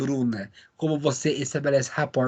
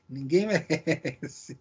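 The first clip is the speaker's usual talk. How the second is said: laughing; 6 dB quieter, much slower than usual. Real, not acted. Laughing at phone glitch.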